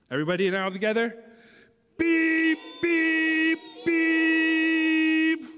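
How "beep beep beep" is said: loud, high, stretched beeps; group truck beeps. A narrator's reading. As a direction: beep all together like horns. Everybody beeps together.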